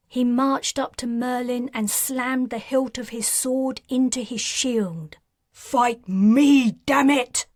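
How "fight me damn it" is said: louder, raging demand; furious challenge. Acted. Patrin challenging Merlin, raging. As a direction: slam it into his face.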